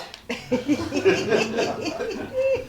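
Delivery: amused laugh; a short burst of laughter. Acted. Laughing at leotard.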